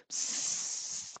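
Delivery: long soft serpent hiss; snake hiss. Acted. Reader showing children a snake hug, with a hiss.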